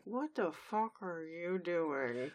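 slow, quiet, menacing mom voice; staring-down mom. Acted. Mom staring down her husband for googly eyes, slow quiet warning. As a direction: draw it out softly as a warning. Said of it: "her mom is staring him down like".

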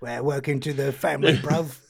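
tipsy Cockney welcome; old accent slipping out. Acted. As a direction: greet him in a put-on Cockney accent. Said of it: "that's my old accent".

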